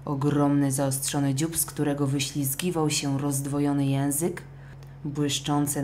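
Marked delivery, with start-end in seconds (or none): none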